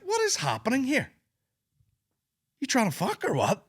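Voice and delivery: high-pitched mock bafflement; incredulous. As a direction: demand an explanation in comic disbelief. Acting out bafflement at lavish gifts.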